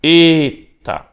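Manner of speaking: "eta" drawn out slowly and loud; "eta" with a long, held first vowel. Shown as a demonstration. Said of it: "pronunciada por mais tempo".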